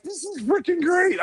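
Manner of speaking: high-pitched gush of enthusiasm; excited exclamation, acted out; retold delight. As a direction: replay a thrilled reaction, voice way up. Retells delight at film.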